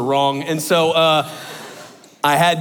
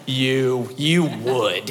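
low-key, disgusted put-down; shaming disgust; 4 semitones lower, much slower than usual. Retold or imitated. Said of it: "the most shaming, disgusting".

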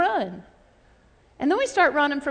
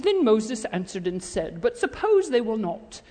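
formal British scripture recital, pitched down; mock British reading; 5 semitones lower than usual. Shown as a demonstration. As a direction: read it like a stiff text, with a put-on British accent. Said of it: "'cause he wasn't British".

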